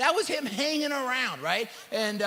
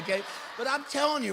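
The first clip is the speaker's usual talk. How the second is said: ordinary talk, nothing marked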